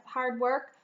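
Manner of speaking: ordinary delivery, nothing marked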